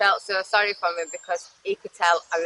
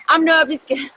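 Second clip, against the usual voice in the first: high, louder innocent denial; naive protest; 8 semitones higher, 6 dB louder than usual. Retold or imitated. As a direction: mimic your younger, clueless self protesting. Acted innocent reply to his question.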